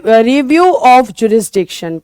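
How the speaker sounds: slowed-down English term; slow term for notes. Shown as a demonstration. Teacher slowly introducing the English term to students. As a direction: say it slowly for them to catch. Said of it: "जिसको हम बोलेंगे रि".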